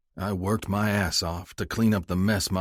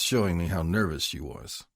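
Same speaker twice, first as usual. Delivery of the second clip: ordinary delivery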